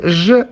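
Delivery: J said as "zhuh", the sound in measure; J as zh, not the letter name; consonant sound demo. Shown as a demonstration. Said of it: "as in measure".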